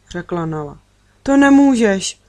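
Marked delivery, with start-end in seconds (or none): 1.2-2.2 higher, louder cry of protest; desperate cry. A narrator's reading. Girl objecting to her friend being taken away, desperate cry. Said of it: "vykřikla jsem zoufale".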